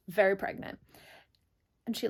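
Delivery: normal talking voice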